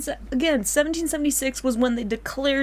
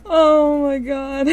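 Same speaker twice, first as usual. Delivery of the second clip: laughing; 5 semitones higher, 8 dB louder, much slower than usual. Real, not acted. Slow laughing groan.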